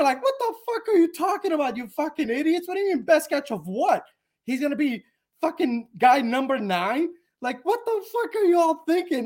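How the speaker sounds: high-pitched ranting questions; incredulous, worked-up scolding; exasperated disbelief. Real, not acted. Rants back at the gushing comments.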